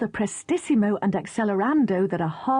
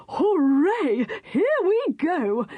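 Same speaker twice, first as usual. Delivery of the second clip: put-on high, slow, gleeful old man's cheer; enthusiastic shout; 7 semitones higher, much slower than usual. Mister Whisker cheers, escaping his nurse, high and gleeful. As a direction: cheer like a freed old man on a joyride. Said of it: "he shouted most enthusiastically".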